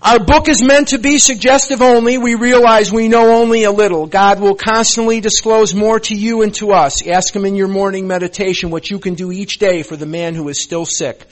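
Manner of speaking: loud, ringing recitation of the Big Book; loud sermon-style reading of the promises; forceful preaching read. Real, not acted. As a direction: proclaim it to the whole room like a sermon. Loud reading of program text.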